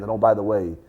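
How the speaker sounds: ordinary talk, nothing marked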